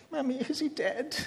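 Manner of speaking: put-on high squeaky toddler voice, half laughing; small child asking in a squeaky voice; squeaky little boy. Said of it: "saying in the squeaky little voice".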